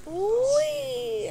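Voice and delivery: high loud sliding whee; gleeful whee. Real, not acted. Player sliding down the track, gleeful high whee. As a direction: let it ring out on the drop. Riding down a slope.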